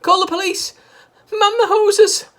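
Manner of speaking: high, loud panicked shout. A narrator's reading. Missus Cranky shouting for help, high and loud.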